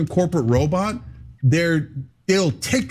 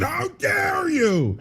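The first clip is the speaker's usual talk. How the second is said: high, stretched-out indignant shriek; way up high and slow, hammed-up outrage; mock outraged shriek; much slower than usual. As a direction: play the scandalized host, all pearl-clutching. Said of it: "they said".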